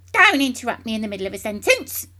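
fast, loud angry shout; loud rapid scolding shout. A narrator's reading. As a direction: bark it at him, furious. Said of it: "she shouted".

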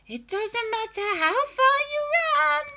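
very high, loud singsong hag; shrieking witch call. A narrator's reading. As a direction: call after him eerily. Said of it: "he heard her saying".

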